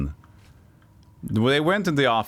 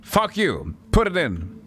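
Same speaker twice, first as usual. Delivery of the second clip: dismissive boss; 7 semitones higher, slower than usual. Retold or imitated. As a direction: brush the artist off with a bright, careless Fuck you. Boss overrides the artist.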